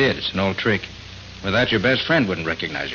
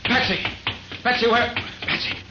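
high urgent calls of her name; calling out urgently; 12 semitones higher, 3 dB quieter than usual. Acted. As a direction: call out to her, alarmed. Nick finds Patsy unconscious, calling.

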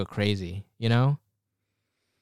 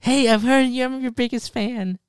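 put-on soy boy meme; 11 semitones higher than usual. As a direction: put on an over-eager, high-pitched fan voice. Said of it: "it's like the soy boy meme".

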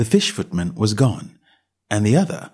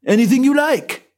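high, indifferent servant voice; a bored doorman brushing her off; dull footman; 4 dB louder than usual. A narrator's reading. Footman answers.

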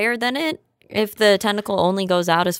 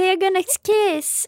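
silly high voice; 13 semitones higher than usual. Pretend.

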